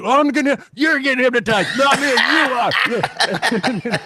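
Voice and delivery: high, slow, laughing denial; nervous audience guy. Retold or imitated. Audience member dodging hypnosis, high and giggly. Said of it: "And the guy's like".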